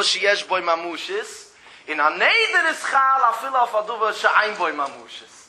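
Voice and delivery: usual speaking voice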